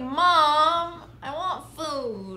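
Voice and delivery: put-on high, slow, whining kid voice; whiny brat kid. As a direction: whine it like a spoiled child. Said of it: "but a whiny brat kid".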